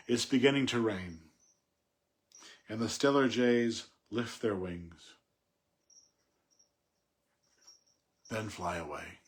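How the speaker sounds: measured read, fading on the last words; poem reading. Acted. Reads closing lines of poem.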